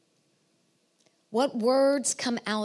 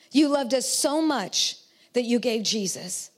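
fervent prayer; 3 semitones higher than usual. Acted. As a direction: pray it aloud over the room, full of conviction. Pastor prays for congregation.

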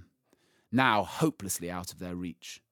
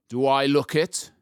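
lion voice; 6 semitones higher, 7 dB louder than usual. Acted. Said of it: "he said".

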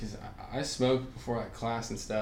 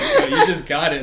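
louder, a laughing mock of the friend; 6 semitones higher, 12 dB louder than usual. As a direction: giggle it out while pointing out the obvious. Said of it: "She's like".

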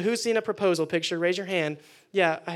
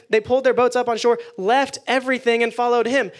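higher and louder, driving each phrase; louder, emphatic rereading; 4 semitones higher, 6 dB louder than usual. Shown as a demonstration. Preacher rereading the verse to the youth group, loud and emphatic. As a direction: proclaim "left everything" like a rally cry. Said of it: "It says in verse eleven".

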